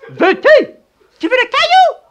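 high loud scolding yell; scolding the dog. Acted. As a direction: shout threats at the dog. (crier sur le chien)

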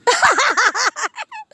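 high laugh. Real, not acted. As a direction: burst of high-pitched laughter. Laughing at Justin's hit.

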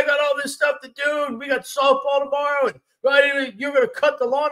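high, hammed-up nagging wife voice, well above his own; mocking wife. Husband mimics his wife nagging about cutting the lawn, high mock voice. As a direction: squeak out her complaints like a frazzled wife. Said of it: "She's like".

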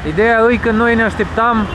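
ordinary talk, nothing marked

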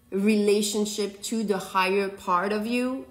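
ordinary talk

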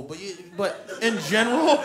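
normal talking voice

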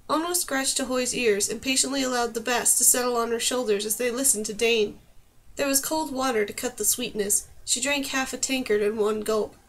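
ordinary delivery

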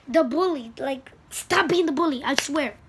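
loud, high angry scolding; angry shouting. Real, not acted. Kid scolding bullies, loud angry shouting. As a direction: shout it out, fed up. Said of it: "now I'm getting so mad".

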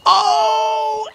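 high, sudden cry of alarm; startled cry. Acted. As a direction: jump at a fright. Startled by Sooty's trick.

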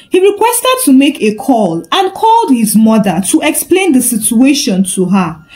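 ordinary delivery, nothing marked

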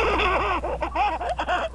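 high, loud cackling laugh; loud laughing fit. Real, not acted. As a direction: burst into high, uncontrolled laughter. Laughing with friend on call.

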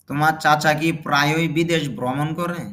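ordinary delivery

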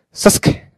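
Sasuke in a quick voiceless burst; clipped Japanese pronunciation. Shown as a demonstration. Teacher demonstrating the Japanese name, clipped pronunciation. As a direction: say Sasuke with the U cut out. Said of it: "lo dicen muy rápido".

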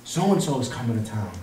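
low, hushed repeat; quiet mocking quote. Preacher repeating the followers' announcement, quietly mocking. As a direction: toss it off softly and drily.

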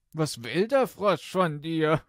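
loud, high, jovial king voice; amused king. Acted. King teases his frightened daughter, loud and jovial. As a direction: boom it out as a teasing royal father.

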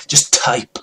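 hushed encouraging whisper; whispered nudge to write. Acted. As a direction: breathe it like a secret tip. Coaxing the listener to write.

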